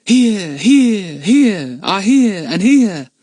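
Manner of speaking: high, loud, slow echoing repeats; echoes. A narrator's reading. The cave echoes repeating Lucy Ann's cry. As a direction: ring it out like a cave echo. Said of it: "cried the echoes".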